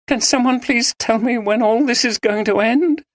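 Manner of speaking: despairing. A narrator's reading. Maggie despairs.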